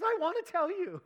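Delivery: high, loud, laughing eagerness, imitating her. Woman finally wanting to share the dream, laughing. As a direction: burst out laughing with it.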